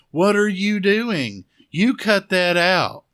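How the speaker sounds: loud, high mock telling-off; imitated scolding. Vera keeps telling off the masked man, loud mock scolding. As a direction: call it out slowly, high and indignant, hammed-up. Voicing Vera scolding who she thinks is Shelly.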